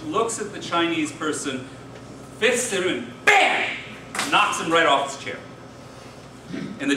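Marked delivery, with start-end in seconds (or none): 3.2-3.7 loud, high "bam"; mimicked punch impact. Telling a joke where one man fists another, explosive bam.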